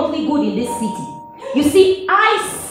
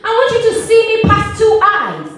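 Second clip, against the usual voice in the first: raised, insistent pleading; impassioned plea; 8 semitones higher, 4 dB louder than usual. Acted. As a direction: plead urgently to be seen, pitched high. Pleading to be truly seen.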